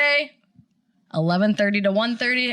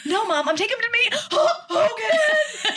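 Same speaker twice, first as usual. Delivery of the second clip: giddy excitement; 12 semitones higher than usual. Retold or imitated. Retelling excited reply.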